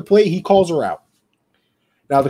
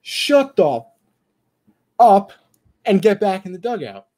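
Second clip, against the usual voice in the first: retold yell; 5 semitones higher, slower than usual. Umpire telling arguing coaches off, mock yell. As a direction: bark it at the coaches to end the argument. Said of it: "So I finally yell".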